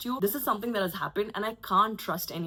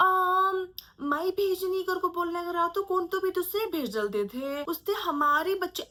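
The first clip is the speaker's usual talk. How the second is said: fast, high rambling in Hindi; babbling excuse; 10 semitones higher, much faster than usual. Retold or imitated. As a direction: gabble the aunt's excuse quickly. Aunt rambles her excuse.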